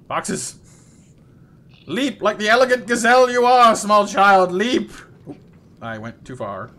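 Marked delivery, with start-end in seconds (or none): 1.9-4.9 loud, higher mock-grand encouragement; hammed-up heroic cheer; grandiose coach.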